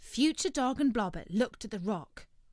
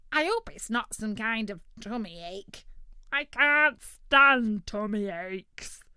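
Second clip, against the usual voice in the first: turkey voice; 3 semitones higher, 8 dB louder than usual. Acted. Turkey worried.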